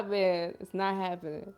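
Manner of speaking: ordinary talk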